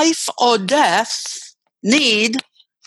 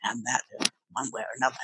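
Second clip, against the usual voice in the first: low voice; 7 semitones lower, 11 dB quieter, much faster than usual. Shown as a demonstration. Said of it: "very low".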